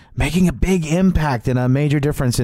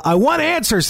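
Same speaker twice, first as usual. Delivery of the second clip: high, mock-urgent demand; playful demand; 13 semitones higher than usual. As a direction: insist on it with a grin. Host playfully demands answers.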